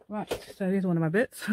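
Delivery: usual speaking voice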